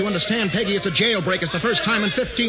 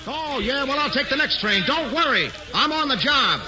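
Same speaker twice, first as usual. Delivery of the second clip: excited; 9 semitones higher than usual. Acted. Hildy phones in the jailbreak story.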